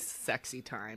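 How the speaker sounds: low, soft and slow sultry tone. Pretend. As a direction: purr it seductively.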